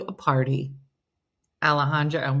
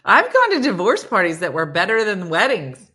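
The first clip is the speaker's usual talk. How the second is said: normal talking voice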